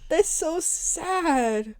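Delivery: high, slow mock crying; fake-sob the words; hammed-up whimper. Friend mock-cries over her co-host's childhood, high and slow. Exaggerated pity.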